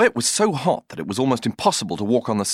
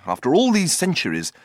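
ordinary delivery

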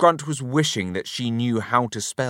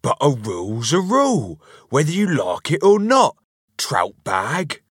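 stubborn insistence; 6 semitones higher, 6 dB louder than usual. A narrator's reading. Husband insists in a squabble.

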